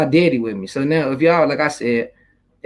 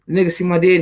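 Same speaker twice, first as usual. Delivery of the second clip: ordinary delivery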